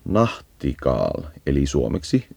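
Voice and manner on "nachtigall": Nachtigall said slowly in German, guttural ch; slow careful German Nachtigall; German pronunciation. Shown as a demonstration. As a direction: pronounce Nachtigall the German way, drawn out. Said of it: "saksankielinen nimi on".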